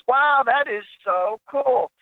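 high, drawn-out awe; mock amazement. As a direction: act out a slow, high-pitched wow. Joke customer amazed by singing bird.

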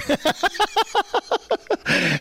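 long high cackle; laughing. Real, not acted. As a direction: laugh in a high, rapid burst. Laughs at own joke.

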